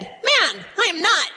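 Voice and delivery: insistent denial. Acted. Peter denies Jesus to an accuser, insistent protest. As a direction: deny it firmly, pitch jumping up.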